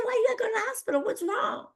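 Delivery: very high-pitched imitation of anxious mother; worried mother. Mother questioning daughter on the phone, worried.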